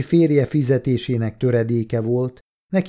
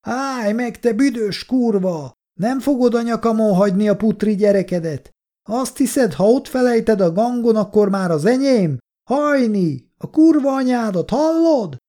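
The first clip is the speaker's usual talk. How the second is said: high-pitched furious yelling; angry yelling man; 11 semitones higher, 4 dB louder than usual. A narrator's reading. Drunk man yelling after the woman, furious and high-pitched. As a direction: yell abuse after her at the top of the voice. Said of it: "a férfi is ordított".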